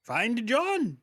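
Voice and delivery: high, loud squeaky order; high goblin voice. Acted. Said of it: "She says it".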